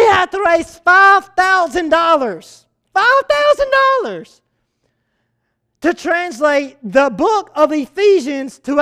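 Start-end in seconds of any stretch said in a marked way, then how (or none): 2.9-4.4 high-pitched, disbelieving repeat of the sum; incredulous exclamation. Acted. Preacher telling the church what kids had to raise, incredulous.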